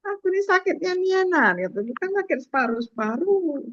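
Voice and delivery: menirukan pasien berlogat Sumsel, nada agak tinggi; logat Sumsel. Retold or imitated. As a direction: logat daerah Sumsel yang lucu. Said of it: "kalau di Sumsel lebih lucu lagi". (pasien Sumsel membantah dokter dengan logat daerah)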